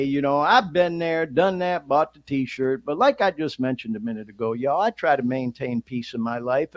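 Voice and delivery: normal talking voice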